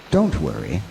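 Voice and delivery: ordinary talk, nothing marked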